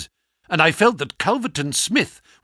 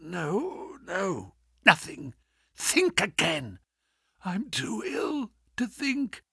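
faint and feeble; 8 dB quieter, slower than usual. Acted. Dying Holmes answers faintly.

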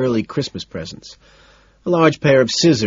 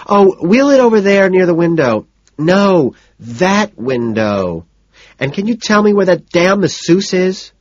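high, louder bossy orders; 10 semitones higher, 5 dB louder than usual. A narrator's reading. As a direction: boss the room service around. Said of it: "addresses someone who has just entered".

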